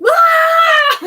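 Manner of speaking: high, loud comic scream; piercing pretend scream breaking into laughter; mock scream. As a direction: let out a sudden hammed-up shriek. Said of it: "Just screams".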